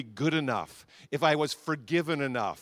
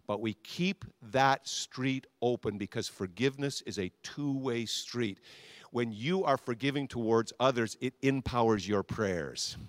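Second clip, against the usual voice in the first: ordinary talk, nothing marked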